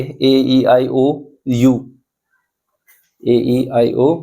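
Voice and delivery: normal talking voice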